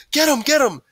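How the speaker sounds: high, loud chasing scream; wild high yell; screamed command. A narrator's reading. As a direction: scream to set the pack on someone. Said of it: "someone else screamed".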